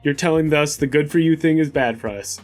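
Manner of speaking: ordinary talk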